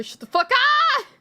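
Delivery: loud, high outburst; sudden shout. Real, not acted. Streamer suddenly telling someone off mid-game, high shout. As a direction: snap into a high yell.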